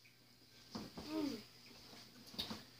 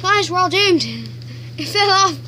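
loud, hammed-up cry of doom; mock panic; 3 semitones higher, 11 dB louder than usual. Real, not acted. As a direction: shout it like the world is ending. Headphone part fell off.

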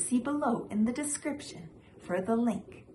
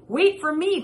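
higher, louder call after someone; 9 semitones higher, 7 dB louder than usual. Acted. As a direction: call out to her husband ahead. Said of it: "called Missus Li".

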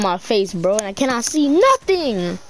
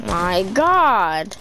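ordinary talk, nothing marked

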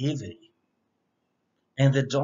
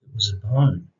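plain reading voice

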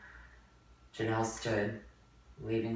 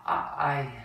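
stammering; 5 semitones higher, 6 dB louder than usual. Acted. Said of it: "there were no words".

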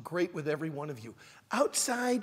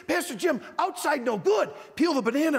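put-on higher, louder kids' voices; kids insisting; 9 semitones higher, 10 dB louder than usual. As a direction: insist like eager children. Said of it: "They said".

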